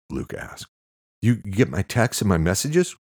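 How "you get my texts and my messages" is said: louder, higher, agitated question; worked-up phone caller; manic. A narrator's reading. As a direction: fire it off keyed-up and anxious. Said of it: "He sounded manic and out of breath".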